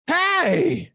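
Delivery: high, loud mock announcer holler; Harry Caray. Ghost sportscaster interrupting the show, high hammed-up holler. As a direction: belt out a ghostly sportscaster's greeting. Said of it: "The ghost of Harry Carey".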